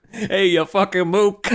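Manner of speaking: slow Boston tough guy, laughing; Boston accent. Pretend. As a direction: Matt Damon Boston drawl, hammed up. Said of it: "Matt Damon with a Boston accent".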